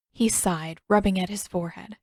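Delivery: plain reading voice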